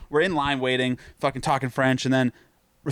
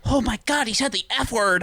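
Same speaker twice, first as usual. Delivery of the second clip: high, prissy gasp of shock; shocked nerd voice; 8 semitones higher than usual. Retold or imitated. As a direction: clutch pearls at a swear. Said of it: "And they're like".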